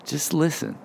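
soft, unhurried guidance with pauses; calming. Real, not acted. Preacher guiding listeners to take a breath, calming. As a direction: ease them gently into a breath.

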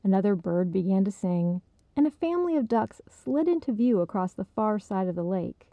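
ordinary delivery

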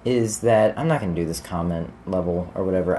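ordinary delivery

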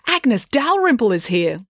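slow, high, excited announcement. A narrator's reading. Loretta spots Agnes Dalrymple in the crowd, excited. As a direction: point her out with drawn-out excitement. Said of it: "said Loretta excitedly".

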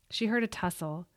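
ordinary delivery, nothing marked

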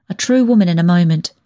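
ordinary delivery, nothing marked

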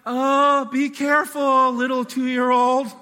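high, loud, slow mock-gentle plea; mock timid parent. Parent limply warning a toddler running at the road. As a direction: whimper a useless warning at the runaway kid. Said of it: "You're like".

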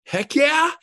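high, loud "Heck yeah"; eager yes. Retold or imitated. Buyer agreeing to an offer, loud and eager. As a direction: burst out the yes. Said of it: "And they go".

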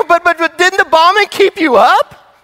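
high, loud stammering disbelief; but-but-but in total disbelief; incredulous family. Retold or imitated. Family asking grandmother how she slept through bombs, incredulous.

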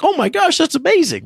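imitated squeal of delight, pitched very high; super excited. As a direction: re-enact a thrilled prizewinner in a high voice. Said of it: "I'm super excited".

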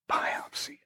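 whispered, almost voiceless; hushed dread. Real, not acted. Preacher repeats the feared word to the congregation, hushed. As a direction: let the word out under your breath.

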